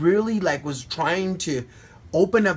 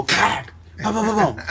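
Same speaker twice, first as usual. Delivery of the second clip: vocal percussive boom effects; punchy mouth-made booms; imitated sound effect. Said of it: "he would make sound effects".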